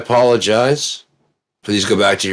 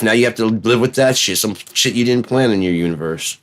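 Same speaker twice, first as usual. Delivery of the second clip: ordinary talk, nothing marked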